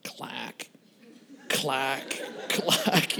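drawn-out imitated clack, spaced apart; slowed-down clacking. Wayne looking over mid-stumble, slow-motion clacking.

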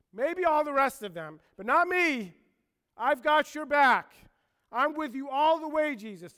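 high, loud, insistent protest; emphatic Peter. Acted. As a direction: swear loyalty at full voice. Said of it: "He is emphatic".

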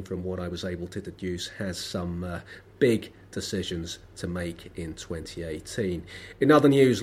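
ordinary talk, nothing marked

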